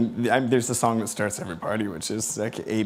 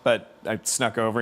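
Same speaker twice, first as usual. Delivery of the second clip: ordinary talk, nothing marked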